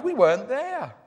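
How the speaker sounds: a hint of an old lady; lightly voiced old lady. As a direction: explain gently as an old housewife. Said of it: "the lady replied".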